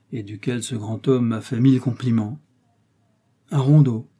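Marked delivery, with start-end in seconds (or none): 3.5-4.1 loud, disdainful echo with a falling end; lightly acted disdain. A narrator's reading. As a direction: scoff mildly at RONDEAU, louder. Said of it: "fit dédaigneusement le jésuite".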